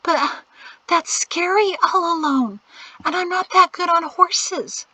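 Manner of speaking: put-on high, timid frightened girl; fearful. A narrator's reading. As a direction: afraid to go alone. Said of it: "Priscille shivered in fear".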